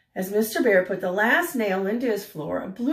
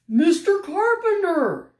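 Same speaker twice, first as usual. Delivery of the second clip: higher, louder call; 8 semitones higher, 4 dB louder than usual. Acted. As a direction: call across to the carpenter. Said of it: "he called".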